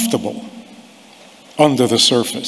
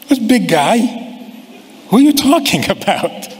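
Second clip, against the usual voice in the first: mocking kid; 7 semitones higher than usual. Kid mocks parent's greeting.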